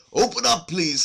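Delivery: high, loud call through the gate; hollow voice. A narrator's reading. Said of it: "someone was saying in a hollow voice".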